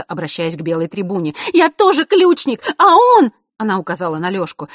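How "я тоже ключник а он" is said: высокий громкий крик девочки; кричи, чтобы услышала трибуна; отчаянный крик. A narrator's reading. (отчаянный громкий крик)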